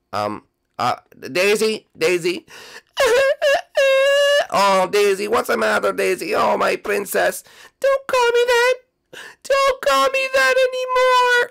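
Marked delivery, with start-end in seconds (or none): 2.7-4.4 high giggle. Acted. Daisy appears, high giggle. 7.8-11.5 much higher, cold princess voice; high-pitched princess, hurt and firm; Daisy's high voice. Acted.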